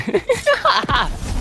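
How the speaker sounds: squeaky burst of laughter; high-pitched laugh. Real, not acted. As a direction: break into a high giggle. Why is that so funny?.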